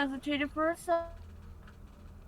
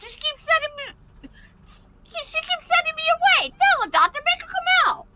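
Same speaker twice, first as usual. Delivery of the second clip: put-on higher, pleading little kid voice breaking into tears; child Sticky; 11 semitones higher, 7 dB louder than usual. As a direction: plead like a stubborn small child close to crying.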